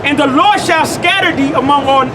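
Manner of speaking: ordinary delivery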